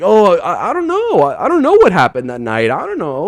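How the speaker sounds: high, swooping mock-clueless voice; hammed-up playing dumb, leaping up in pitch; [name] feigning ignorance. Punter trying to save himself, mock clueless. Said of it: "I gotta try to save myself".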